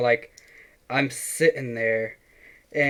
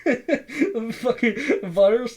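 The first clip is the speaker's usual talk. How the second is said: words squeezed out through real laughter, much higher and slow; laughing; 11 semitones higher, slower than usual. Real, not acted. Friend mocking the scam mishap, laughing through words. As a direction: laugh while you say it.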